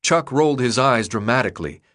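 plain reading voice

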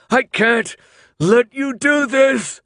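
very high, loud, slow drawn-out scream; anguished protest screamed. Acted. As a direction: stretch out a desperate scream. Thomas fighting them, protesting.

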